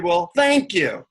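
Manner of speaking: slow, drawn-out sarcastic thanks; snide. Retold or imitated. Pissed-off seeker thanks the guru, acted snide sarcasm. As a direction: thank him as if you mean the opposite. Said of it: "I give him a snide".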